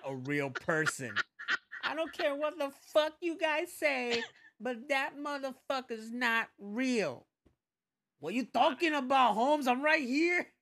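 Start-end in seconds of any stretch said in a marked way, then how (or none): 1.8-10.5 high, loud put-on voice; loud mock tough-guy rant; mock character voice. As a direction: ham up a tough guy yelling. Mocking voice in loud banter.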